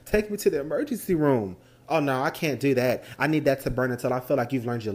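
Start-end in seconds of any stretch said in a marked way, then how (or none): none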